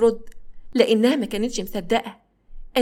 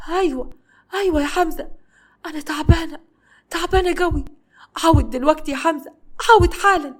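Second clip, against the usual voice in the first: tearful pleading; 7 semitones higher, 3 dB louder than usual. A narrator's reading. Wife crying, begging husband back.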